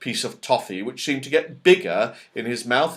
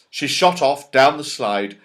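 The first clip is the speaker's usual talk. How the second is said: ordinary delivery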